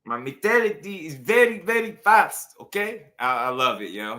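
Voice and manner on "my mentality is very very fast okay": put-on high-pitched, clowning Cuban accent; hammed-up Cuban accent, pitched way up; mocking Cuban accent. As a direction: parrot the fighter in an exaggerated Cuban accent. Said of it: "Y'all know I just be clowning with the accents".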